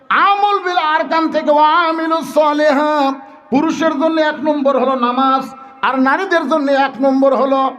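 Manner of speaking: chanted, high sermon delivery; high-pitched preaching. Real, not acted. Preacher returns to the topic after a digression, high preaching. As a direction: call the congregation back in ringing phrases.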